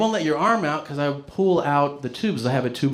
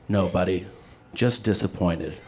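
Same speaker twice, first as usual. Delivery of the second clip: low, subdued fatherly letdown; imitated disappointed dad; 5 semitones lower than usual. Dad answering his kid's note, low and disappointed. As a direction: drop the voice like a let-down father. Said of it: "my dad reads it and he goes".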